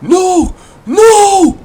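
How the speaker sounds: escalating cries of NO, ending very high and loud; anguished yell. Acted. Disturbed Kratos yells out at the burning statue, anguished. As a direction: build to a full-throated scream of denial. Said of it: "He yells out".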